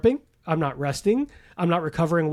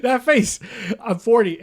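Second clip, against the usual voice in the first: laughing, louder and higher; laughing brag; 6 semitones higher, 6 dB louder, slower than usual. Real, not acted. Bragging with laughter about looking young at forty. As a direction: chuckle through the brag.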